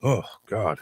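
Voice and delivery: ordinary talk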